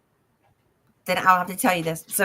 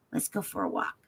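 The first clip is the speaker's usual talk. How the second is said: tender whisper; 12 semitones lower, 10 dB quieter than usual. Retold or imitated. Quoting grandfather tenderly.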